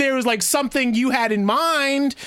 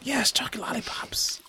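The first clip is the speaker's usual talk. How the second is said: whisper; 3 semitones higher, 8 dB quieter than usual. Real, not acted. Savoring candy, whispered.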